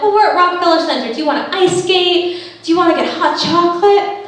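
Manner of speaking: high and fast eager suggestions; eager pitch. Retold or imitated. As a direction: rush to pitch fun ideas, hopeful.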